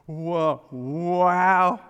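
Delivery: loud, high gasp of wonder; knocked speechless by what he sees; amazed wow. Acted. Adam meeting Eve, loud amazed cry. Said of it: "he goes".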